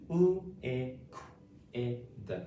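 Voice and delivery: L, I, Q, I, D sound by sound; phonics-style L, I, Q, I, D. Shown as a demonstration. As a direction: segment it with short gaps between sounds. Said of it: "saying these sounds and words".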